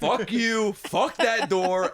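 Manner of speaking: reenacted furious comeback, punching each word; retold angry shouting. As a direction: yell back at dad, acted out. Retold shouting match with dad.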